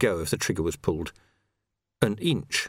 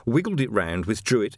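usual speaking voice

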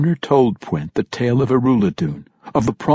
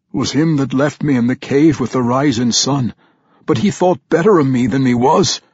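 loud, high dwarf brogue, downcast; vampire dwarf recalling his maker; dejected vampire dwarf; 5 semitones higher, 5 dB louder than usual. Acted. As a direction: tell it with bitter regret. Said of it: "looked down at the floor dejectedly".

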